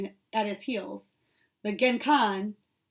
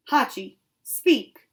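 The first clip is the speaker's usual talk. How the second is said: repeated command; 2 semitones higher, 6 dB louder, much slower than usual. A narrator's reading. Repeating a command to silent dog.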